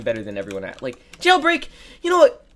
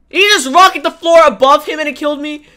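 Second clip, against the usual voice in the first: loud, high indignant cry; outraged disbelief; 11 semitones higher, 11 dB louder than usual. Real, not acted. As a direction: cry out, can't believe it. Outraged disbelief after dying.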